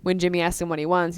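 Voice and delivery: ordinary delivery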